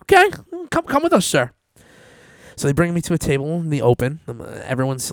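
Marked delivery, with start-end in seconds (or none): 0.0-1.5 high, loud, fast mocking officer; sarcastic high-pitched gotcha; suspicious officer. Customs officer catching his slip, loud high sarcastic voice. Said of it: "I go / officer reply".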